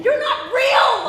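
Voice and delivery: shouting in anguish, high and loud. Acted. Wife screaming at her dead husband's ghost, shouting. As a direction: hurl it at him in despair. Lovers quarrel inside a dream.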